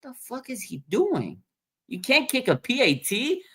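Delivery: high, incredulous outburst; stunned disbelief. Retold or imitated. Fan rewatching the missed kick, high incredulous outburst. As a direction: voice jumps up in disbelief. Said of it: "you're like".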